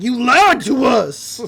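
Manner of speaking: slow, loud accusation; hurt big man calling out a liar; betrayed Manly Dan. Retold or imitated. Manly Dan accusing Gideon, slow and loud. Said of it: "Manly Dan's like".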